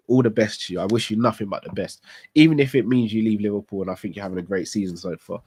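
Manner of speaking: ordinary talk, nothing marked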